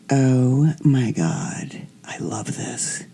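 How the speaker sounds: breathy hushed whisper of thrill; whispered delight. Retold or imitated. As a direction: whisper it like a guilty pleasure.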